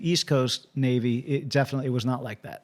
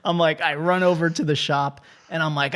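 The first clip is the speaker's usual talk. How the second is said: ordinary talk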